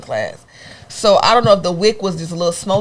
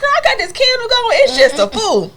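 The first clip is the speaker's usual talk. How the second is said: much higher voice, covering nervously; high nervous excuse; 3 dB louder than usual. Retold or imitated. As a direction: fake innocence to mom. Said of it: "I was like".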